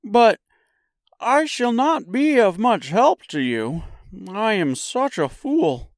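high-pitched character voice; high straw man's apology; self-doubting Scarecrow. A narrator's reading. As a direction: confess it in a high, emphatic voice. Scarecrow admits uselessness.

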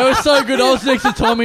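ordinary talk, nothing marked

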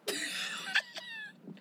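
very high mock shriek; acted high shriek. Guest retelling getting the test, mock high shriek. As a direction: squeal out a wordless reaction. Guest acts out his reaction.

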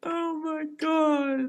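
very high, soft, slow oh my God; high breathy gasp. Pretend. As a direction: sigh it out in falsetto. Amazed reaction.

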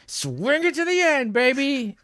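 high, drawn-out mock heroic yell without much volume; hammed-up battle cry. Host imagines going down fighting, hammed-up battle cry. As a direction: holler it like a doomed action hero. Acting out his heroic death.

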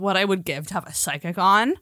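normal talking voice

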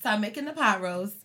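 ordinary delivery

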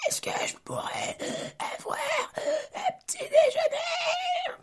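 strained hissing rasp, then a high squeak; hissing snake voice. Retold or imitated. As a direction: wheeze it out like a snake. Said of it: "il parle comme un serpentard".

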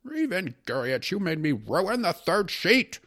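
high, loud, exasperated shout; outraged judge at the end of patience; furious judge. Acted. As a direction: yell in despair, stretching the words. Judge shouts at the distracting secretary.

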